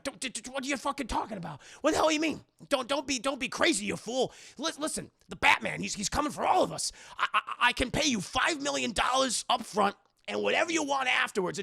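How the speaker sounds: acted desperate urgency; panicked pleading. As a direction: plead in a frantic stutter.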